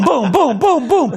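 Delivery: usual speaking voice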